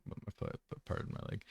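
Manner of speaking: ordinary talk, nothing marked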